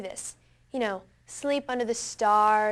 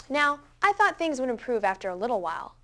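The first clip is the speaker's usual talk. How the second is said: usual speaking voice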